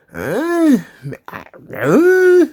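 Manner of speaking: high, rising questioning grunts; baffled mock "uh". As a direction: imitate someone lost for words, pitch swooping up. Imitates Sami's baffled reaction.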